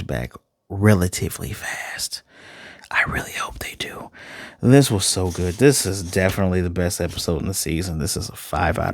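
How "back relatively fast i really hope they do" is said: hushed aside, unvoiced breath; whispered hope. Real, not acted. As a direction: drop to a whisper for the wish. Trails into a whisper.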